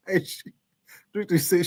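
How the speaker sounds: ordinary delivery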